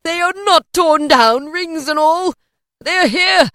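loud, high, jubilant insistence; exultant cry. A narrator's reading. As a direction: shout each phrase in overjoyed relief. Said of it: "cried Scrooge".

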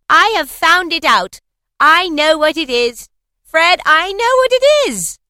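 high, loud triumphant shout; excited cry. A narrator's reading. Plump sister crying out the answer, loud and high. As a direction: burst out with the answer. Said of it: "cried out".

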